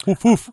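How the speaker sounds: two high mock barks; play-bark, high and punchy; playful dog woofs. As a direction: imitate a little dog barking. Playful dog imitation on dog days.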